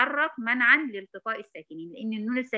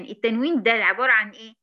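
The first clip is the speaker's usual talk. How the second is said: usual speaking voice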